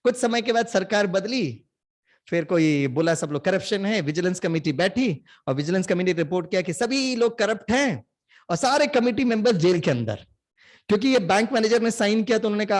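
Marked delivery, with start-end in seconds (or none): none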